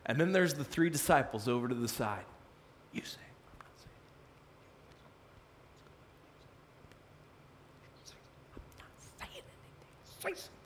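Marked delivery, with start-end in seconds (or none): 2.9-10.5 hushed urgent whisper; secretive nudging whisper. Retold or imitated. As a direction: whisper it under your breath.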